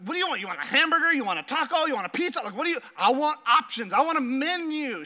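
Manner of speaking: hammed-up wife demanding choices; mock-exasperated wife asking for a menu; imitating wife. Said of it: "She's like".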